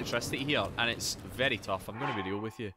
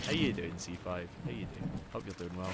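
quiet greeting; 7 semitones lower, 7 dB quieter than usual. Real, not acted. Softened greeting after shouting apology.

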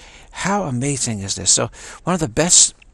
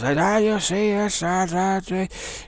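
high, slow, slurred calling voice; imitated yelling man; 7 semitones higher, much slower than usual. Man in wheelchair trying to talk, imitated yelling.

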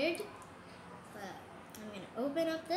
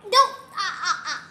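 laughing; 3 semitones higher, 15 dB louder than usual. Real, not acted. Laughing while doing lips.

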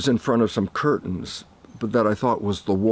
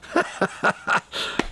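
laughing; 10 semitones higher, 3 dB quieter than usual. Real, not acted. Laughs after world change.

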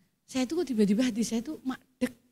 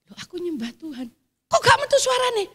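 startled protest; 8 semitones higher than usual. Retold or imitated. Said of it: "kaget, Saudara".

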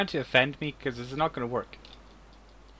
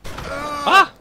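startled yell; 13 semitones higher, 12 dB louder than usual. Real, not acted. Shot suddenly in game.

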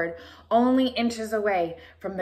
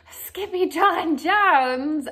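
loud, slow, high call; 7 semitones higher, 5 dB louder, much slower than usual. Acted. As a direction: yell the name in alarm. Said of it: "everyone cried".

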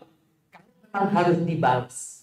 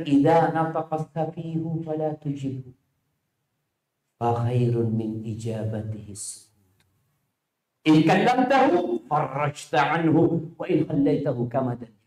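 slow Arabic recitation; slower than usual. Acted. Quoting Imam Syafi'i poem.

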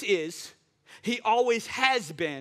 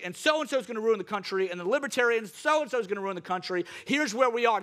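usual speaking voice

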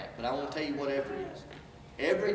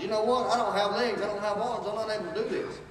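mock griping; 7 semitones higher than usual. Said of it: "gripe and complain and say".

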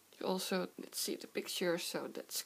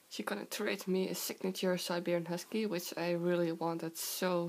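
ordinary talk

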